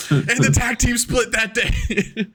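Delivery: high, slow, sing-song proclamation; laughing mock announcement. Host narrating the podcast duo's split, laughing mock announcement. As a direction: draw it out through laughter.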